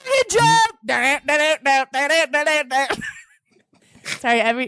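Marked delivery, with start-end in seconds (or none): 0.0-3.0 high, processed voice with effects; effects-laden laugh; sound effect voice. Acted.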